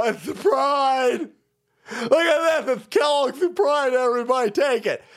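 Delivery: high, drawn-out mock sales cry; hammed-up hawking. Cereal maker hawks a Pride mix to everybody, hammed-up hawking. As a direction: crow it out like a hammed-up pitchman.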